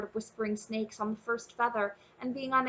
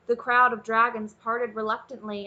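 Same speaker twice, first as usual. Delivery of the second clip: ordinary delivery, nothing marked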